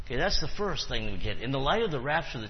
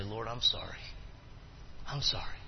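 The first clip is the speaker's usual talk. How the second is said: penitent prayer; 4 semitones lower, 8 dB quieter than usual. Acted. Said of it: "bow your head and say".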